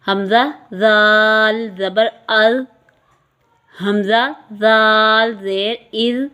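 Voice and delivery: حمزہ زال spelled out slowly, each letter drawn long; slow spelling drill. Shown as a demonstration. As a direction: spell it out slowly for pupils to repeat. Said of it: "آپ میرے ساتھ ساتھ پڑھیے گا".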